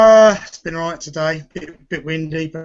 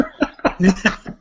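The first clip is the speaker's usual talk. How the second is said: short laugh; 4 semitones higher than usual. Real, not acted. Laughs before repeating.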